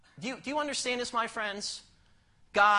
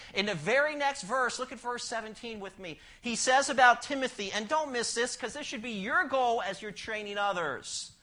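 normal talking voice

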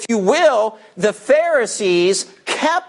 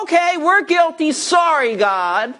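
higher, lightly mocking apology; mock repentance; 7 semitones higher than usual. Preacher imagining the Pharisees repenting, mock contrite. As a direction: play the Pharisees pretending to be sorry. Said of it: "They go".

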